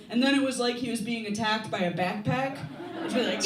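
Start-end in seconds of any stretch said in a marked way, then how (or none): none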